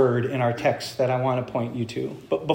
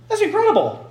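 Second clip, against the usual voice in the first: amazed exclamation; 14 semitones higher, faster than usual. Real, not acted. Marvels at the idea.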